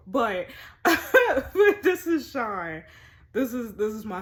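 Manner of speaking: laughing intro. Real, not acted. Introducing her husband, laughing.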